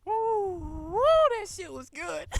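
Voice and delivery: hyped whoop of delight; excited. Real, not acted. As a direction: cheer it out, thrilled. Hyped reaction after the episode.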